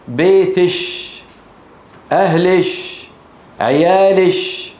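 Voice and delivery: بيتش، أهلش، عيالش ending in "-ish"; baytish, ahlish, ayaalish; kaf as sheen. Shown as a demonstration. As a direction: close each word on a long sh, عيالش drawn out. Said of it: "يجعل الكاف المؤنثة المخاطبة شين".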